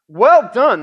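exclaimed. A narrator's reading. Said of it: "the king exclaimed".

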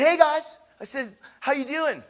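high, loud hail; retold call-out. Preacher greeting kids outside, retold high call-out. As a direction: call brightly across to a group.

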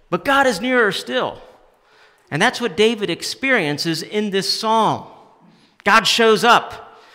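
high, loud preaching, slowing on "God shows up"; fervent sermon climax; preaching at full force. Acted. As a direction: proclaim it to the whole room.

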